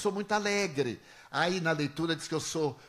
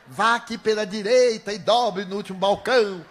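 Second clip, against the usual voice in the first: raised, louder instruction voice; loud directions; 3 semitones higher, 7 dB louder than usual. Retold or imitated. Coaching the clerk to guide a blind man, loud directions. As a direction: call out directions as if guiding someone blind. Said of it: "Fale alto".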